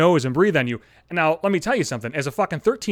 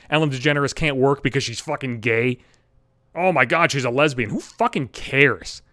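ordinary delivery

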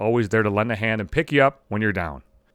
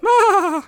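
high, loud imitating cackle; mock hysterical laugh; 6 dB louder than usual. Host imitates friend laughing at his show, mock hysterical. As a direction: mimic her hysterical laughing. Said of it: "She's laughing hysterically".

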